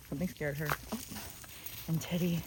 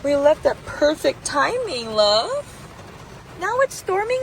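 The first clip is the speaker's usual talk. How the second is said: louder and high, slow and amazed; excited relief; 8 semitones higher, 6 dB louder, slower than usual. Real, not acted. Telling her dog about the storm, loud and amazed. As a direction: marvel at the timing. Storm after leaving trail.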